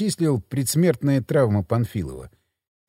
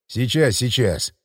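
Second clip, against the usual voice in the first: panting; 4 semitones lower, slower than usual. A narrator's reading. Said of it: "Часто задышал я".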